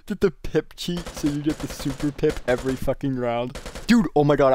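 ordinary talk, nothing marked

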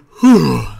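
big audible yawn; loud yawn. Real, not acted. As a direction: let out a loud, tired yawn. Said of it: "There's my yawn for this video".

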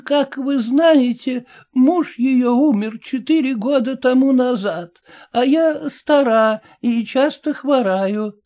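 put-on high, slow elderly lady voice; a frail old woman explaining patiently; lightly aged lady, unhurried. A narrator's reading.

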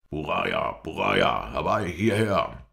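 loud, drawn-out call for help. A narrator's reading. Sheikh summons his men from the valley entrance, calling out. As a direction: call loudly, stretching the last word. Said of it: "hörte ich es am Eingang des Tales rufen".

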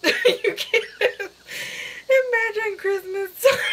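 high voice breaking into real giggles; constantly laughing. Real, not acted. Telling viewers about Christmas time, laughing helplessly. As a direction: talk through helpless laughter. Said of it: "Just me constantly laughing".

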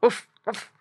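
high imitated pig oinks; two high, short piggy noises; pig squeal. A narrator's reading. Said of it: "squealed Squinty".

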